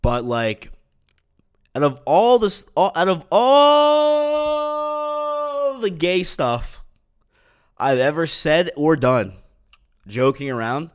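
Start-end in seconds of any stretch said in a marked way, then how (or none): 2.8-6.8 high, loud and slow, stretching out all; drawn-out emphasis. Real, not acted. Riffing to podcast listeners, drawn-out high emphatic all. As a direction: lean hard on all, dragging it out in disbelief. Building to an exasperated punchline.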